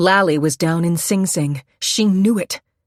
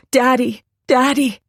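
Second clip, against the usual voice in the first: put-on child calling out, a little higher; attempted yell; 5 semitones higher, 3 dB louder than usual. A narrator's reading. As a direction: call for Daddy, straining to yell. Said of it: "he tried to yell".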